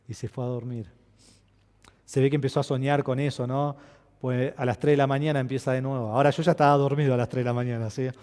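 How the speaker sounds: normal talking voice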